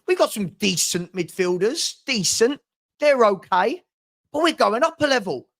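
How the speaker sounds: usual speaking voice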